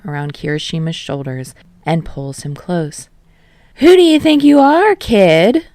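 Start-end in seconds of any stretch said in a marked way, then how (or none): 3.8-5.6 loud, high, brash challenge; demanding. Acted. Yamada grilling the young singer, loud and brash. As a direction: bellow it like a showman. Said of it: "Yamada demands".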